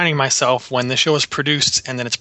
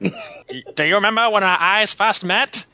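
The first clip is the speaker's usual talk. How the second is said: put-on slow, higher old newsreel announcer; old-timey news voice; 6 semitones higher, slower than usual. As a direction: croon it like a vintage newsreel. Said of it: "I couldn't help but hear it in the old-timey news voice".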